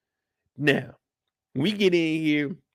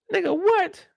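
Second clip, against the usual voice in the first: shocked; 11 semitones higher than usual. Real, not acted. Shocked reaction to reveal.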